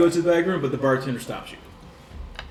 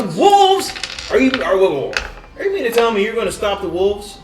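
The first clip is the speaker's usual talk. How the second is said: high, loud disbelieving whoa; astonished barkeep; 6 semitones higher, 4 dB louder than usual. Acted. Barkeep hearing the party will stop the wolves, amazed. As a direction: react like you can't believe your ears.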